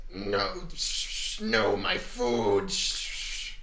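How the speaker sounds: creature voice. Acted. GM voices hissing spider creature.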